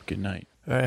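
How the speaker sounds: ordinary delivery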